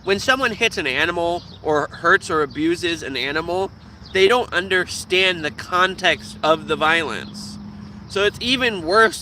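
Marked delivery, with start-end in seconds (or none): none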